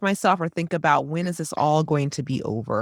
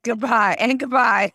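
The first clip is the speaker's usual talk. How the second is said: mock hurried goodbye; 6 semitones higher, 4 dB louder, slower than usual. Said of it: "It's like".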